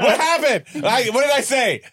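high, loud mock panic; mock alarm. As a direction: act shocked, voice jumping up. Mock panic over hacked mic.

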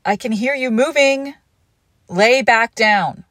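loud, slow call from afar; calling out firmly. Acted. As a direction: call out sternly from the next room. Scolding partner from other room.

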